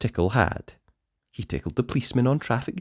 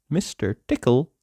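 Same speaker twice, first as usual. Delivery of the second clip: usual speaking voice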